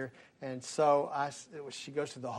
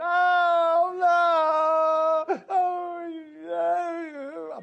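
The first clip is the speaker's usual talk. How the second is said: put-on high, loud wailing of a boy; crying boy noises; 13 semitones higher, 7 dB louder, much slower than usual. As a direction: wail like a kid stuck in the car. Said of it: "Boy, I can make the noises".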